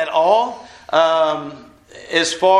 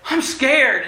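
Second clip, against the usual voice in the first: higher-pitched, imitating a frightened boy; mock scared child; 6 semitones higher than usual. Little boy tells mom in a storm, mildly scared. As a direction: lightly act a little boy's fear. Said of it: "a little boy who was laying".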